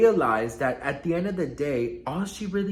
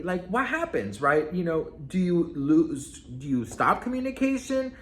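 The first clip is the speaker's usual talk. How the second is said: ordinary delivery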